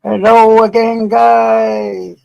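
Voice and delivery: big, slow, high hello to the viewers; loud greeting. Real, not acted. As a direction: call out a drawn-out hello to everyone. Loud drawn-out live greeting.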